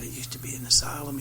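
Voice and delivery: hushed secretive whisper. Retold or imitated. Retold local man's secret tip.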